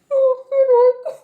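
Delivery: very high, loud squealing laugh-cry; high laughing sob. Real, not acted. Overcome retelling Peeta's grief, squealing laugh-sob. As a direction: break into a sobbing laugh.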